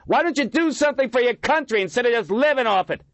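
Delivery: high, loud screaming taunt; young man screaming at the homeless leader. A narrator's reading. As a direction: scream the accusation. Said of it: "the man screamed".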